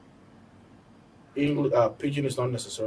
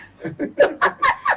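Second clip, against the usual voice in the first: high laugh; 12 dB louder than usual. Real, not acted. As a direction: burst of high loud laughter. Laughs at the Pidgin demo.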